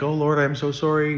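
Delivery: mock-contrite sinner, lightly overplayed; mock sorry prayer. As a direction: parody a cheap, empty apology to God. Mocking hollow repentance prayer.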